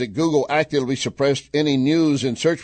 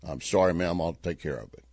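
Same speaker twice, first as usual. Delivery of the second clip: meek apology; 6 semitones lower, 7 dB quieter than usual. Acted. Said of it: "now she goes to him".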